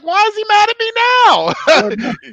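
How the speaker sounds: high, slow, baffled whine; mock innocent confusion. Retold own baffled reaction.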